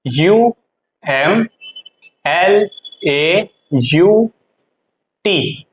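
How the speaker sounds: very slow, one letter at a time; spelling out. Shown as a demonstration. Teacher writing a new term, slow letter-by-letter spelling. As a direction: spell it with gaps between letters. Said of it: "क्या बोलते हैं?".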